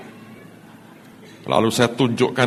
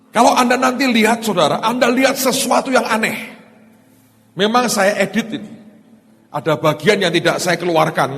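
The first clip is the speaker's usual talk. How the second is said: preaching at full force; 11 semitones higher than usual. Acted. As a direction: drive the message home to the church. Preaching to the congregation. (berkhotbah dengan suara tinggi dan lantang)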